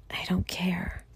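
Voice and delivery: real whisper, barely voiced; hushed admission. Real, not acted. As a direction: let it out very softly, almost soundless.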